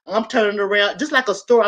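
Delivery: usual speaking voice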